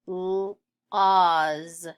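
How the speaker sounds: held "l", pause, loud long "oz"; sounded out. Shown as a demonstration. Teacher sounding out laws for pupils, sounded out. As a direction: separate l from the rest.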